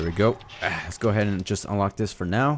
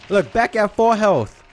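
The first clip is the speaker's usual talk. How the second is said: thrilled, drawn-out shout of relief; excited; 12 semitones higher, 5 dB louder, slower than usual. Real, not acted. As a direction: yell it out, overjoyed. Just healed to full health.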